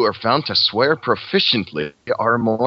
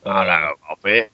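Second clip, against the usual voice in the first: lightly voiced Cockney greeting. Friend takes a turn at the impression, light Cockney. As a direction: have a go at Cockney. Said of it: "Muse impression as doing a Cockney voice".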